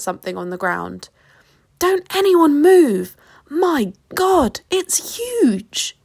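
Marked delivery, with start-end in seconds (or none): 1.8-6.0 high, slow warning cry; horrified, high-pitched alarm. A narrator's reading. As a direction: warn everyone to freeze, voice pitched high. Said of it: "went several shades paler and screamed".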